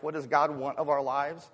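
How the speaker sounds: ordinary delivery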